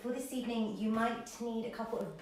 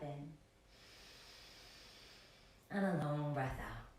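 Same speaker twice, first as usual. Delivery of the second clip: soft, lower voice with long pauses; guided meditation; 3 semitones lower, 6 dB quieter than usual. Acted. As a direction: ease them gently into stillness, leaving space between phrases.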